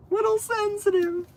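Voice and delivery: "Little sensitive" tossed off high and teasing; mocking echo. Mocking echo of own words.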